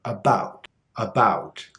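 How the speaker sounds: "About" with a wide open ow vowel; larger American about. Shown as a demonstration. Teacher demos the larger American vowel in "About". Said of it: "the average American would pronounce it larger".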